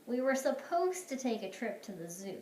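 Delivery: plain reading voice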